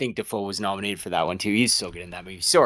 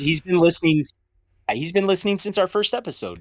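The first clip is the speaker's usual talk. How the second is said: excited; 9 semitones higher, 4 dB louder than usual. Real, not acted. Joking excited insistence.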